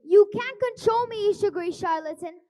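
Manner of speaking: loud defiant outburst; defiant shout. Acted. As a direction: shout back at the villain. Captive defies the villain.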